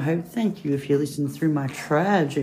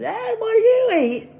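very high, loud, drawn-out squeal; wide-mouthed frog; 7 dB louder than usual. Acted. Frog asking the bear its diet, very high slow squeal. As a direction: stretch the question out in a squealing frog voice. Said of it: "the wide-mouthed frog goes".

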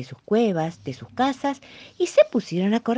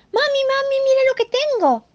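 excited calling; 5 dB louder than usual. Acted. Bunny calling to mother.

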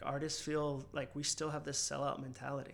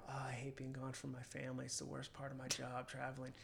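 softer, mildly self-pitying mock moan; mock complaining; 10 dB quieter than usual. Musician griping on Instagram about travel, mock complaining. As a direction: quietly imitate a complaining social media post.

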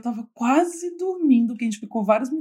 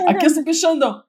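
louder, higher meme reply; raised voice; 6 semitones higher, 4 dB louder than usual. Retold or imitated. Half-asleep girl answers the meme in a raised voice. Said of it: "ela só levantou a voz".

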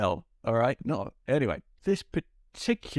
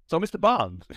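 Walken; 7 semitones higher, 4 dB louder than usual. Acted. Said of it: "channel my Walken".

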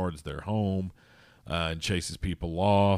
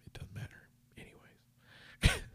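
a breathy, dismissive whisper to drop the topic; whispered aside; 10 dB quieter than usual. Real, not acted. Vlogger giving up on the tangent in a whisper.

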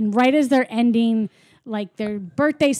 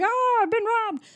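much higher-pitched mock alarm; mock outcry; 13 semitones higher than usual. Acting out characters who find they are robbed, mock alarm. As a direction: wail it in hammed-up dismay.

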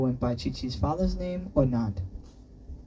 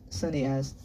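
ordinary delivery, nothing marked

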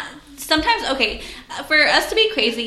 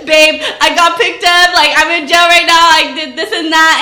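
loud, pleading boyfriend imitation, higher pitched; mock-urgent boyfriend on the phone; boyfriend calling from jail; 4 semitones higher, 10 dB louder than usual. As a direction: act out his urgent confession from jail. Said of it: "And he's like".

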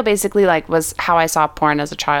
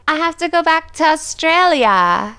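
mock Valley Girl; 8 semitones higher than usual. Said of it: "she's like".

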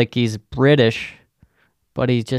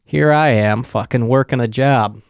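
usual speaking voice

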